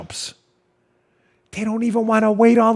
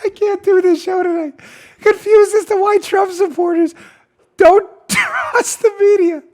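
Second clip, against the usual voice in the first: much higher, real laughing voice; helpless laughing; 11 semitones higher than usual. Real, not acted. Host unable to read the news story, high laughing disbelief. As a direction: struggle through the sentence while cracking up. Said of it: "I'm laughing at my own show".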